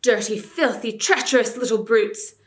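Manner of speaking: loud furious insults; raging. A narrator's reading. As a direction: spit out insults dancing in rage. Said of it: "dancing in his rage".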